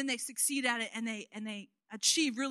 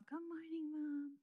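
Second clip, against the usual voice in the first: higher, much softer sleepy little voice; little child's greeting; 5 semitones higher, 12 dB quieter, slower than usual. Retold or imitated. Toddler greeting mom in the morning, sweet and soft. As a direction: chirp it like a toddler waking up.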